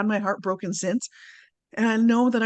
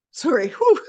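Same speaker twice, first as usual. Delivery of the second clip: higher, louder, choked-up apology; real tearful overwhelm; 6 semitones higher, 5 dB louder than usual. Real, not acted. Speaker apologises for crying mid-story, tearful overwhelm. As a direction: catch yourself welling up and exhale. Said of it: "I'm crying about it now".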